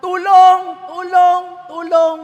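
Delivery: loud, high cries for help; acted shout of someone stranded. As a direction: yell out to anyone who might hear. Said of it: "At sumisigaw niya".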